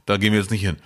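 usual speaking voice

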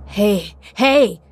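impatient, escalating bark; snapping at someone. A narrator's reading. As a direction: snap to get their attention. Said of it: "fuhr Kit mich an".

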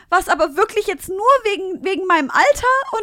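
put-on high, loud disbelieving boy; pleading young guy. Mimicking the cyclist at her car window, high and pleading. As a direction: plead like a stunned teenager. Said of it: "Und er so".

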